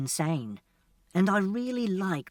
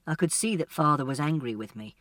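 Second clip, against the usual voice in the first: usual speaking voice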